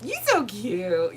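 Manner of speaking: drawn-out gushing coo; mock cooing. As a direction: imitate people fawning over a cute little thing. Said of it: "people are just like".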